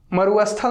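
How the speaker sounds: मरुस्थल with an extra अ, as मरु-अस्थल; wrong pronunciation. Shown as a demonstration. Teacher showing students what to avoid, wrong मरु-अस्थल. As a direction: say मरुस्थल wrongly as maru-asthal. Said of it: "नहीं".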